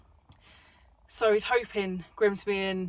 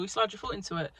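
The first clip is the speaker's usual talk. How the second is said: ordinary talk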